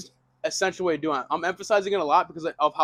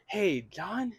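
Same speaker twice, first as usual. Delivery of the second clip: quiet questioning hello; 3 semitones higher, 7 dB quieter than usual. Shown as a demonstration. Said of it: "You can do this by saying".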